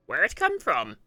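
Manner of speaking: loud goblin voice, questions swooping up at the end; uneasy goblin questioning. Acted. Goblin wondering where the cap came from, loudly uneasy. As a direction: demand answers as a loud, puzzled goblin.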